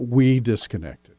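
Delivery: "we" stretched out slowly; stress on we. Shown as a demonstration. Coach fixing the student's wording, stressing "we". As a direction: lean long on "we". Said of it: "framework of we".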